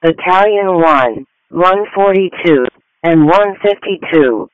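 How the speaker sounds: ordinary delivery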